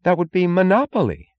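high, loud, shocked protest; Mooch. A narrator's reading. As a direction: object as if scandalized. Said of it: "gasped Mooch".

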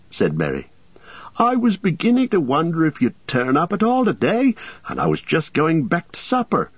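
amused teasing; Merry. A narrator's reading. Merry greets the frightened farmer, amused.

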